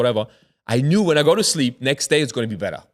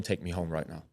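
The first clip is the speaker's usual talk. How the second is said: low, soft, scared plea; hushed demand; 6 semitones lower, 12 dB quieter than usual. Retold or imitated. Telling the driver to take him home, hushed. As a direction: demand it under your breath, pitched down.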